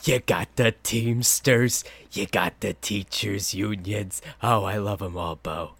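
slow mock radio guy voice; lightly voiced sports radio host; imitating the Cuz.

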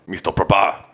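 fake German accent. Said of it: "you try to do German".

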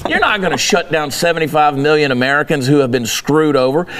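ordinary delivery

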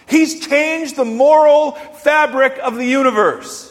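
high, driving sermon delivery; impassioned preaching. Real, not acted. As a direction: proclaim it to the congregation with rising force. Preaches at full force.

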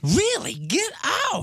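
can't believe what he just heard; shocked disbelief. Real, not acted. Stunned British is not ranked.

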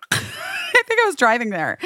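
high laughing burst into speech. Real, not acted. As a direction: laugh as you start talking. Laughs while correcting her story.

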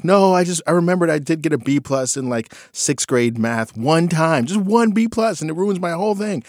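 mock the daughter's despair; upset daughter. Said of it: "And she was like".

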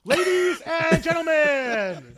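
high, slow, booming announcer call. Pretend. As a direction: announce it like a big event. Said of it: "You got a good announcer voice".

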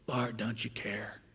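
quiet mock plea. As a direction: mutter the complaint under your breath. Quiet mocking repeat.

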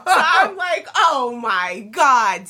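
laughing. Real, not acted. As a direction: crack up while telling it. Laughing at her own story.

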